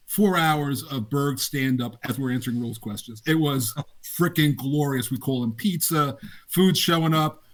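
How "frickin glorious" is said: usual speaking voice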